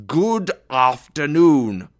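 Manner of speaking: slow, loud and high; angry dismissal. A narrator's reading. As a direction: stretch each word out forcefully. Scrooge dismisses nephew yet again.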